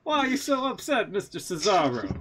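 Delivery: high, hammed-up Jamaican accent sliding toward Italian; loud mock-Jamaican call, then a high lilting question; Kofi Kingston. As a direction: put on a bad sing-song Jamaican accent. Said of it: "you do a Jamaican Kofi Kingston".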